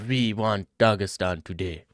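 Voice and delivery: put-on Indian accent, mock declaration. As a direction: pretend to be Indian. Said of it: "Pretend to be Indian and say".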